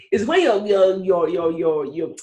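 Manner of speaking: ordinary delivery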